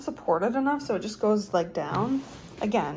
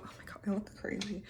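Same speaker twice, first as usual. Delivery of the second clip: whispering; 13 dB quieter, faster than usual. Real, not acted. Whispered aside.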